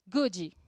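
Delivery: "goody" with an added ee after the d; two-syllable good. Shown as a demonstration. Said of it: "vou falar das duas formas".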